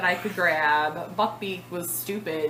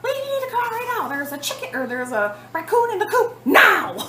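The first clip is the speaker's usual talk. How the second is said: retold yell; 13 semitones higher, 6 dB louder than usual. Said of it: "I scream downstairs".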